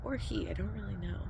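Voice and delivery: soft whisper aside; whispered aside while waiting for the cat. Real, not acted. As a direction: keep it hushed so the cat stays.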